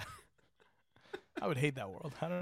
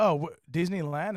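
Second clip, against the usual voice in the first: surprised; 5 semitones higher, 5 dB louder, slower than usual. Retold or imitated. Surprised coworker in story.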